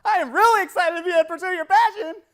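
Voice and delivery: very high, full-volume shout; over-the-top excitement. Shown as a demonstration. Speaker showing tonality to audience, wildly excited shout. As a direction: yell it out with maximum enthusiasm. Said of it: "three different ways".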